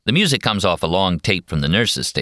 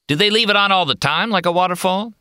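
irritated cowboy; 14 semitones higher than usual. Acted. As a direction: gripe about the racket. McMurphy asks.

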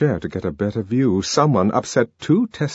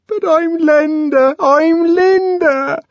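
very high, loud, slow insistence; cling to him and insist; desperate Linda; 6 dB louder, slower than usual. A narrator's reading.

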